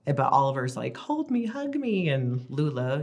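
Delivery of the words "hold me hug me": put-on much higher, slowed little-boy plea; clingy toddler. Mom imitating her clingy toddler son. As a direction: ask to be held like a needy toddler.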